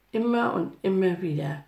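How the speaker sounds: usual speaking voice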